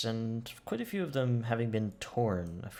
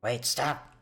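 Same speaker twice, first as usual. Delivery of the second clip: raised, excited kobold call; urgent kobold halt; 3 semitones higher, slower than usual. Acted. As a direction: call off the attack in short bursts. Kobold halts an ambush on kin.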